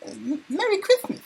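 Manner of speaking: very high, lisping Mike Tyson voice; Mike Tyson impression. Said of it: "in my Mike Tyson voice".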